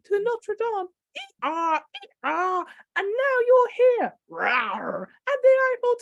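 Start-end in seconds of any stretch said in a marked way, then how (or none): 1.2-2.6 donkey bray. Acted. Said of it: "random animal sounds". 4.3-5.0 low growling rawr. Acted. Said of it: "random animal sounds".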